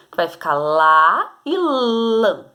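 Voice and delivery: "lá" open and oral, then "lã" nasal, both slow; teacher contrasting the two a sounds; lá versus lã. Shown as a demonstration. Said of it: "Acento agudo e o acento tio".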